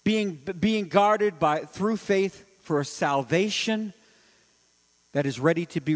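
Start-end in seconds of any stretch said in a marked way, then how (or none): 0.0-3.9 slow reading. Acted. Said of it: "slows scripture reading".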